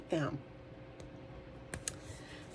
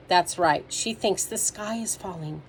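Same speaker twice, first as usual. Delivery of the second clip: ordinary delivery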